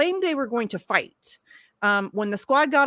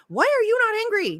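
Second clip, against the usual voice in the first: much higher pitched demand; incredulous rant; 9 semitones higher than usual. Real, not acted. Ranting at critics who call her too angry, incredulous high demand. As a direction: hurl the question at them in disbelief.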